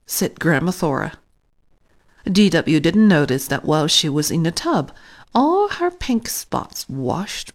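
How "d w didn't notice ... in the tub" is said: ordinary delivery, nothing marked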